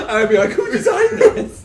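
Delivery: high, loud laughing outburst; loud, incredulous joke, cracking up. Real, not acted. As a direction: say it through real laughter. Joking through laughter at the table.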